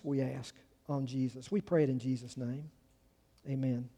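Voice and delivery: hushed pastoral prayer; closing prayer. Acted.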